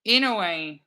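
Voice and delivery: ordinary talk, nothing marked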